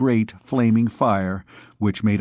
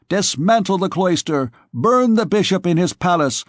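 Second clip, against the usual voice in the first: higher, bold swaggering youth's voice; boisterous young scamp; 6 semitones higher than usual. Acted. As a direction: brag to the thieves like a reckless young noble. Jehan's voice held for long speech.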